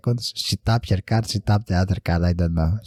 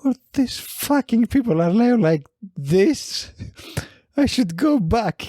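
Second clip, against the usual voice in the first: much higher, exaggerated indignant voice; mimicked huffy woman; 12 semitones higher than usual. Lady complaining to the narrator, much higher and exaggerated. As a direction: camp it up in outrage. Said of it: "She was like".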